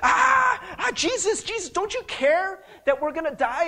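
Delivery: a desperate yell of fear in the storm; panicked cry. Retold or imitated. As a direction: cry out like men about to drown. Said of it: "the disciples are freaking out".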